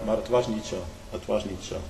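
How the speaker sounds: ordinary talk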